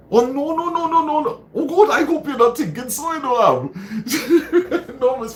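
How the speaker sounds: high, loud laughing no no no; squealing laughing protest; laughing denial. Real, not acted. Laughing denial to viewer comment.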